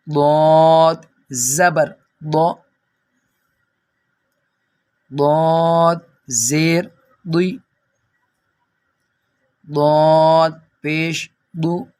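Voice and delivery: ضاد drawn out slowly with a heavy, full d; full heavy sound. Shown as a demonstration. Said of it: "ہم پر پڑھیں گے". (پُر آواز میں ضاد کے ہجے)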